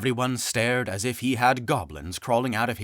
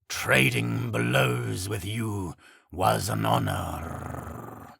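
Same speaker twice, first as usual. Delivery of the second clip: solemn and unhurried; creature voice; 2 semitones lower, slower than usual. Acted. Harpygriff speaks.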